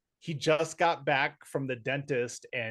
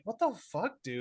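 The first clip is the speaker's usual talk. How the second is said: incredulous; 6 semitones higher than usual. Retold or imitated. Reacting to Arnold quote.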